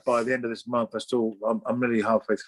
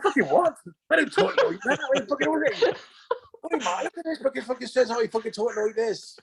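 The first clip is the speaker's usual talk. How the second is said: laughing outrage; 13 semitones higher than usual. Retold or imitated. Retold outraged reaction, laughing.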